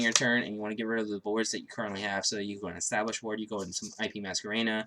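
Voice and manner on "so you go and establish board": ordinary talk, nothing marked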